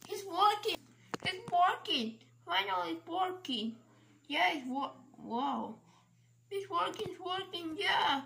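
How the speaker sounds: high, loud excited cheer. Pretend. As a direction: cheer that it finally works. Toy fixed, cheering it works.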